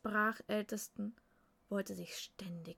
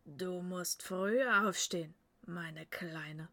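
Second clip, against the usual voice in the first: grinning father; 6 dB louder than usual. A narrator's reading. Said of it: "grinste Asmund".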